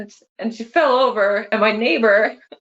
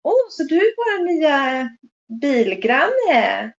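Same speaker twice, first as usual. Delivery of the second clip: higher sweet chirpy greeting in Swedish; imitating mom; 5 semitones higher, slower than usual. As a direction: voice mom cheerfully meeting a new neighbor. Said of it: "my mom says in Swedish".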